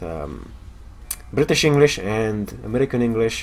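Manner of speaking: normal talking voice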